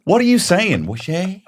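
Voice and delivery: high, loud disbelief; acting out a child's outraged confusion; mock incredulous outburst. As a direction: leap up in pitch, hammed-up bafflement. Retold outrage at hearing about sharing.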